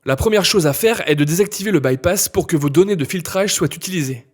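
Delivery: normal talking voice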